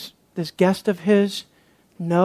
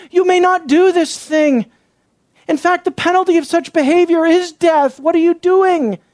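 forceful rebuke; 8 semitones higher than usual. Acted. Acts out imagined Levite's rebuke.

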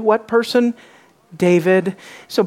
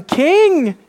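high, loud, drawn-out proclamation; full-voiced, soaring cry; shouting; 10 semitones higher, 5 dB louder, much slower than usual. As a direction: shout it out like a beggar hailing his king. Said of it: "as he's shouting".

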